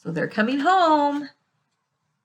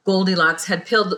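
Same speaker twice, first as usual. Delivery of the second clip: usual speaking voice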